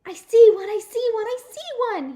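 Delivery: very high, loud thrilled shout; excited shouting. Acted. Sheldon spotting an egg, excited shout. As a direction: yell it out, thrilled. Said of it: "he shouted".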